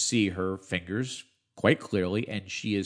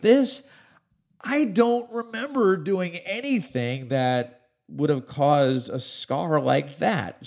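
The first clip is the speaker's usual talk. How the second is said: incredulous; 8 semitones higher, 5 dB louder, slower than usual. Real, not acted. Puzzled about unexplained scar.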